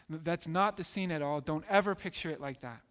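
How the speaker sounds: ordinary talk, nothing marked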